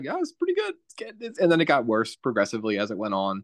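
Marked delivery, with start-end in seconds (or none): 0.0-1.4 mock hopeful viewer. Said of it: "I was like".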